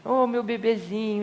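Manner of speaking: slow, tender mother cooing; baby talk. Acted. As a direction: coo lovingly to the baby. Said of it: "fica assim".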